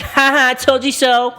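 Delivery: high, loud taunt; mock gloating. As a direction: crow it like a hammed-up winner. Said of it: "being like".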